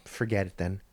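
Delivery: mock sulky dismissal; muttered brush-off. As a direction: mutter it off under the breath.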